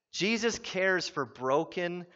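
usual speaking voice

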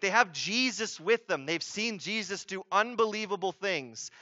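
ordinary talk

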